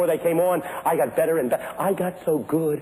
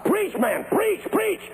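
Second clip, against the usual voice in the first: urging cries climbing higher; imitated deacons' yell; 10 semitones higher, slower than usual. Deacons up front yell to preacher, imitated urging cries. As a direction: egg the preacher on, rising in pitch. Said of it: "They yell".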